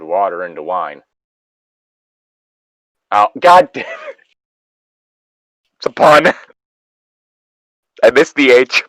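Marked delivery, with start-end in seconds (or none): 3.1-8.8 loud, higher exasperated groan at himself; real annoyance at a fumbled pun; louder exasperated self-scolding; frustrated outburst. Real, not acted.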